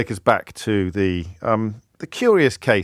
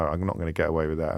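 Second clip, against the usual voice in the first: usual speaking voice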